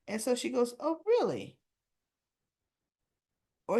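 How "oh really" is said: higher-pitched, intrigued question; surprised interest. Retold or imitated. Anna hears he teaches at the university, surprised interest. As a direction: play an intrigued reaction to news. Retold reaction to professor's news.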